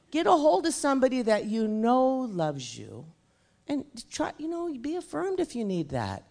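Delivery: loud, driving preacher's voice; preaching at full force. Acted. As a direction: preach it hard to the crowd. Preaching about fear to congregation.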